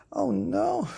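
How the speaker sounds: high loud despairing Oh no; fed-up bear wails; exasperated groan. Acted. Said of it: "said Daddy Bear".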